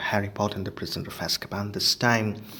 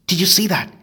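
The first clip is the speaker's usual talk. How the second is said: higher, louder excited question; 9 semitones higher, 7 dB louder than usual. Acted. Harry thrilled at his first wisp, excited and louder. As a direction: burst out with delight. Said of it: "said Harry excitedly".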